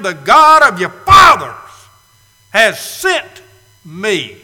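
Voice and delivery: preaching at full force; proclaimed shout. Acted. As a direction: declare it to the whole hall. Preacher proclaims Moses' words.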